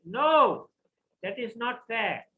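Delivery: loud, high cry of refusal; a grieving king refuses outright; anguished protest. Acted.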